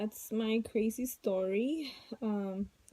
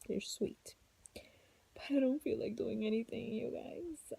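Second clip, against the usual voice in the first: whisper; 2 semitones higher, 5 dB quieter than usual. Real, not acted. Whispering with kids nearby.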